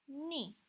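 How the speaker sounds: "ni" falling from high; fourth tone. Shown as a demonstration. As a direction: drop "ni" straight downhill. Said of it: "one, two, three".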